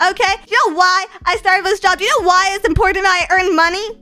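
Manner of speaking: usual speaking voice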